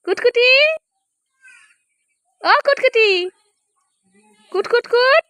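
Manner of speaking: high, slow cooing pet names; sing-song coaxing. Real, not acted. To the sulking cow Three Star, high cooing pet names.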